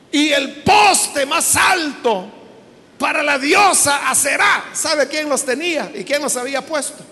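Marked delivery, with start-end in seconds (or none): none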